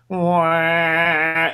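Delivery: high, sustained "waaah" drone; imitated tire noise. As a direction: mimic a movie tire's eerie power noise. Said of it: "cuts to the tire and goes".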